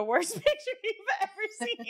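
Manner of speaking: high laughing through words. Real, not acted. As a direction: laugh while saying it.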